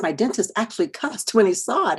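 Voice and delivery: ordinary talk, nothing marked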